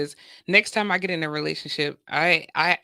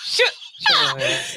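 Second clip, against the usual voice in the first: high squeaky scolding voice; squeaky high mock scold; slower than usual. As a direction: squeal it through a laugh. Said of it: "they like".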